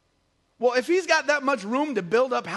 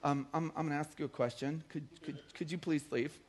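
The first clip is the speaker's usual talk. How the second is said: much softer, lower, hesitant mock-polite ask; mock timid request; 8 semitones lower, 14 dB quieter than usual. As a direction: stammer it out like a timid clerk. He doesn't walk in and he say.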